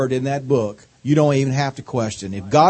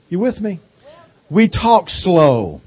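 slow Southern drawl; 4 semitones higher, slower than usual. Shown as a demonstration. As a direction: stretch every syllable, Southern style. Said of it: "this wonderful Southern drawl".

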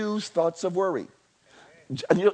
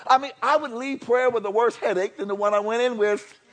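ordinary delivery

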